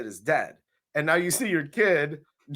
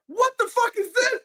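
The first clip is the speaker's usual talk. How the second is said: much higher mock shocked shout; hammed-up disbelief; shocked outburst. Acting mother's shocked reaction.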